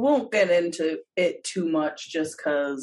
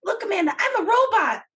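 put-on kid robot voice; 13 semitones higher, 4 dB louder than usual. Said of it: "in the little kid room".

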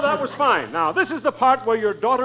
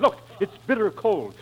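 normal talking voice

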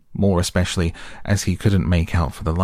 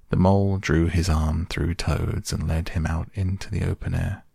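usual speaking voice

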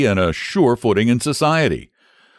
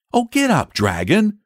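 put-on high, pleading small boy; piteous boy's cry, acted by a narrator; piteous plea; 12 semitones higher than usual. A narrator's reading. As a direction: plead like a worn-out child. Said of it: "cried the boy piteously".

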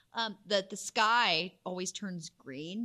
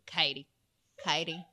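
put-on Louisiana accent; 5 dB louder than usual. Louisiana relatives calling her name, in their accent. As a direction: say Katie the way Louisiana family do. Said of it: "when they say my name, Katie, they go".

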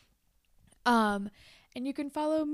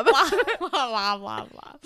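Linda Belcher voice; 6 dB louder than usual. Acted. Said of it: "I sound kind of like Linda Belcher".

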